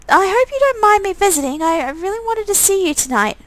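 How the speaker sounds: put-on timid girl visiting at night; shy girl voice. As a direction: voice Sabrina, bashful and hopeful. Sabrina speaking to player character.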